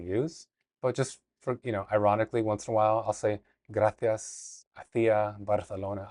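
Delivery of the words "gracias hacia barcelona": playful Castilian accent, lisped th; Spain accent. Shown as a demonstration. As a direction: say it like a Spaniard from Spain. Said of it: "I'll use their accent just to have fun".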